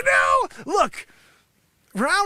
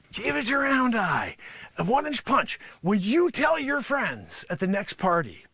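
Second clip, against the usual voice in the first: ordinary talk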